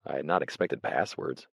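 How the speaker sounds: plain reading voice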